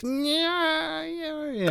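slow, high pained yeah; wincing. Real, not acted. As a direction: cringe through the words. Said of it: "I wince when I'm reading".

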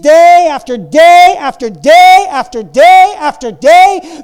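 loud, slow, driving preacher cry; fervent preaching. Acted. Pastor urging the congregation forward, full-force preaching. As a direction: hammer it home to the congregation.

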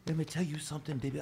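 intense soft whispered yell. Retold or imitated. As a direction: hiss it like a wrestling promo. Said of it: "He's a big whisper yeller".

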